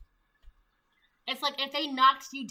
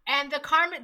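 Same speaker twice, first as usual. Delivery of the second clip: ordinary talk, nothing marked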